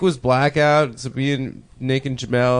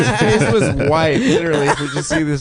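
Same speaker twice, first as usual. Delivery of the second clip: high, loud voice through real laughter; laughing while talking; 9 semitones higher, 4 dB louder than usual. Real, not acted. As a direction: tell it while cracking up. Cracking up retelling friend's shock.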